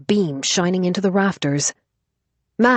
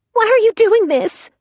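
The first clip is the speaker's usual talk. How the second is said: high, loud desperate cry; 6 dB louder than usual. Acted. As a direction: plead in alarm. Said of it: "Max cried".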